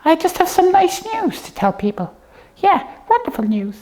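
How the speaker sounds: usual speaking voice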